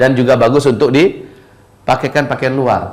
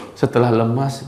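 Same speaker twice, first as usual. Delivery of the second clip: usual speaking voice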